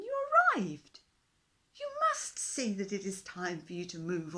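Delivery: a small king standing his ground; earnest fish king. A narrator's reading. Fish king firmly tells the dinosaur to move on.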